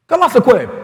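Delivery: high, loud, pushed-up father voice asking where; father's puzzled question. Retold or imitated. Father asking his son where college is, loud and high. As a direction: play the baffled father pressing his son.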